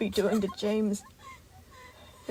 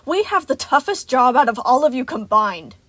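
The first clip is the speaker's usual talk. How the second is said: loud, higher, offended protest; indignant explosion; 4 semitones higher, 9 dB louder than usual. A narrator's reading. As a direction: burst out in outrage. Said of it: "absolutely exploding with noise".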